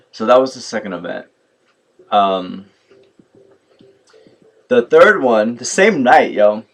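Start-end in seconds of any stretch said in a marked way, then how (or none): none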